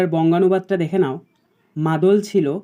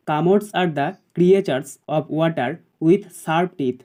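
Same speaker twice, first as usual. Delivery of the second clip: slow dictation; much slower than usual. Shown as a demonstration. Teacher dictating an answer.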